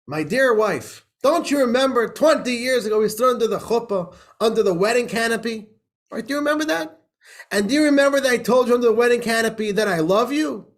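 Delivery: hammed-up devoted husband; earnest husband. Husband voiced in a joke.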